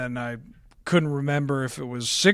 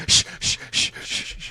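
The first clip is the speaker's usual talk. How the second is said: repeated unvoiced shushes; mock shushing; 3 dB quieter than usual. Slock mimics putting an opponent to sleep, mock shushing.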